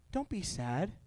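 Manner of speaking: very soft imitation of a condoler; mock consoling. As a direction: hush it like well-meant funeral comfort. Mimics consoler at funeral.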